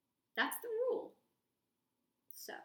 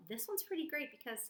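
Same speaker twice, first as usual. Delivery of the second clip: plain reading voice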